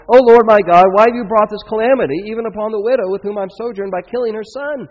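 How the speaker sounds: loud, high plea to God; desperate prayer flung up loud; crying out. Acted. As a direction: cry it up to heaven in anguish. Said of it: "He cries out to the Lord".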